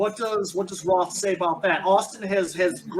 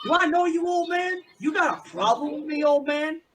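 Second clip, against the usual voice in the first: threatening outlaw; 7 semitones higher than usual. Acted. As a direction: pick a fight with the old man. GM voices Franklin threatening.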